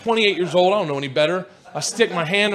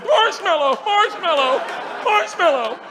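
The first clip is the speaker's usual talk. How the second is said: high, desperate repeated cry; acted helpless yelping; panicked plea; 8 semitones higher than usual. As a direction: call out a safe word in alarm. Yelling safe word, shaken by bear.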